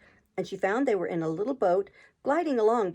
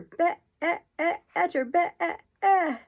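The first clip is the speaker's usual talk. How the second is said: high, louder long bleat on be-e-e; sheep bleat; 9 semitones higher, 4 dB louder, much slower than usual. A narrator's reading. Queen turning into a sheep, long bleat. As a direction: turn the word into a bleating sheep. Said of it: "The last word ended in a long bleat".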